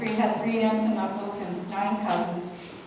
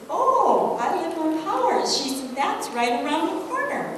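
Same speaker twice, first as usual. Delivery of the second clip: put-on quoted girl, bright and eager, higher and louder; delighted schoolgirl; 7 semitones higher, 4 dB louder than usual. Schoolgirl tells the new girl she lives nearby, delighted and lively. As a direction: pitch up, sound thrilled to be neighbours.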